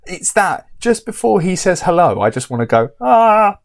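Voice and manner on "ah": sudden high yelled "Ah"; short mock scream, loud and high; pretend scream. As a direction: burst out to break the cinema silence. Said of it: "sort of scream in the cinema".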